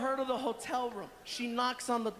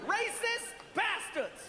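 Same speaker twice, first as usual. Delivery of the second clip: mock outraged yell; 10 semitones higher, slower than usual. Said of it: "we heard".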